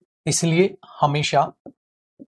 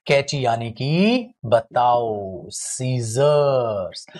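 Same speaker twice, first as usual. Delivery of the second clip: very slow, stretched seizures; drawn out; 4 semitones lower, much slower than usual. Shown as a demonstration. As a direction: stretch seizures out slowly for the class. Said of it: "teacher drawing out answer". (Seizures को खींचकर धीरे बोलना)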